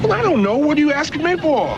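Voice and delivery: high-pitched, defensive comeback; exasperated retort. Acted. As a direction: throw the question back, exasperated. Heated argument over mistaken identity.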